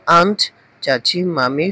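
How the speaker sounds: usual speaking voice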